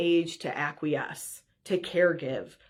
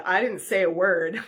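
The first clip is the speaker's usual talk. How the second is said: ordinary talk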